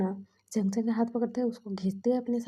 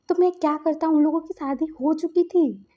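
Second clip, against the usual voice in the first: exasperated; 6 semitones higher, 8 dB louder than usual. Acted. Friend defends himself against anger.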